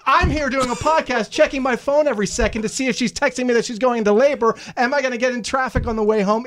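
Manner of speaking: ordinary talk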